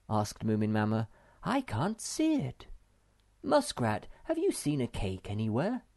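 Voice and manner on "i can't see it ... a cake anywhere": high-pitched searching mother voice; motherly questioning. Acted. Mother asks the muskrat about cake, high and searching. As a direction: ask around in a high mother voice. Moomin Mama hunts for cake.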